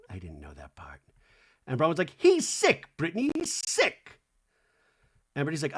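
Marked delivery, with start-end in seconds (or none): 0.1-1.0 tiny, low whisper. Retold or imitated. Brittany's reaction repeated under breath. 2.2-4.2 high-pitched, emphatic accusation; mock outrage. Acting Bronwyn's outraged reply.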